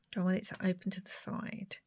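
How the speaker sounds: quick unvoiced whisper. Real, not acted. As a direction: murmur it to yourself. Whispering to herself.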